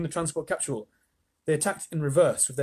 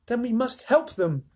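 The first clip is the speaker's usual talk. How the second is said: higher, urgent cry; alarmed boy; 9 semitones higher than usual. A narrator's reading. Charlie cries out to Wonka, higher and alarmed. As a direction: call it out in alarm. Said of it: "cried Charlie".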